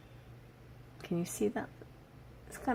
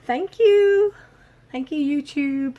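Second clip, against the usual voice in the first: high, loud, stretched-out "thank you"; big sign-off thanks; 9 semitones higher, 10 dB louder, slower than usual. Real, not acted. Vlogger signing off to viewers, exuberant thanks. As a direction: sing out your thanks.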